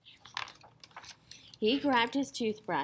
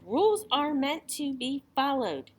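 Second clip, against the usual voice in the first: loud, higher insistent shout; a child yelling the rule; 6 semitones higher, 5 dB louder than usual. A narrator's reading. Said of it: "Ian shouted".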